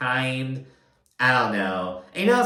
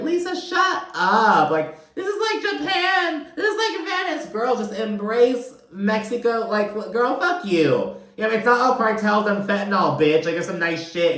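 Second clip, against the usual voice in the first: roast her in a high mock voice; high sarcastic rant; sassy mock rant; 10 semitones higher than usual. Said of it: "It's like".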